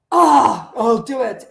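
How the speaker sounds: loud, drawn-out groan, then a grudging I'll do it; imitating a whiny son. Son caught not brushing teeth.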